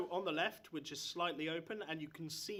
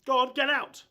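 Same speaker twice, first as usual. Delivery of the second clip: loud, higher barked orders to leave; angry dismissal; 8 semitones higher, 13 dB louder than usual. Acted. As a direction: throw the guards out of the room. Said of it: "And he goes".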